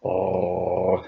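usual speaking voice

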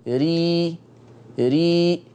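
ري with a thin r; light ra. Shown as a demonstration. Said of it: "طيب الراء المرققة".

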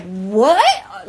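loud, stunned rising "what"; shocked disbelief. Retold or imitated. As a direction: blurt it out in retold disbelief. Retold shocked reaction.

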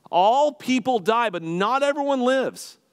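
high, loud, slow declaration; shouting the line. Real, not acted. Pastor urging the congregation, a loud slow proclaimed cry. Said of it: "to yell that out to you".

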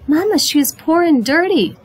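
put-on high, swooping child's objection; indignant protest. A narrator's reading. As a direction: protest to mother, pitch leaping up. Esperanza protests to her mother.

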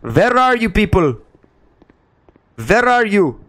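lightly put-on vampire accent, "Vhere are you people". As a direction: hunt them like a mock Dracula, light vampire accent. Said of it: "I want to suck your blood".

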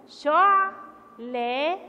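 شعلة very slow and loud, halted on ع; loud syllable-by-syllable شعلة; pause on the ayn. Shown as a demonstration. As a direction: break شعلة into "sho'", pause, "la-tun". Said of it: "وقفت عند حرف العين لأنه حرف ساكن".

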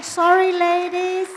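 high, loud call to the room; loud apology. Real, not acted. Speaker apologises to the ladies for overrunning, calling out high and loud. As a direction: sing out an embarrassed apology. Calls apology to the audience laughingly.

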